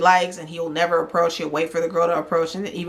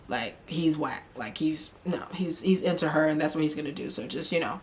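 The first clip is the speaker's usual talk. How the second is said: softer, half-whispered confiding aside; hushed aside; 8 dB quieter than usual. Real, not acted. Host confiding to viewers, hushed whisper. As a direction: lean in and whisper it.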